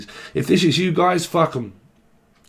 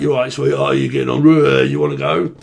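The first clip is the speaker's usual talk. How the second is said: exaggerated London street slur, louder; rough London accent; 5 dB louder than usual. Shown as a demonstration. Reader showing how people in London speak, rough London accent. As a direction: run it together in broad, improper London speech. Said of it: "don't really speak proper. Speak like that".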